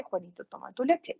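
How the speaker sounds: soft, dropped-away echo of the phrase; a child's quiet echo, almost under the breath. Shown as a demonstration. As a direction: repeat it quietly to yourself. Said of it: "esto lo pueden hacer inmediatamente o que estén toda la tarde".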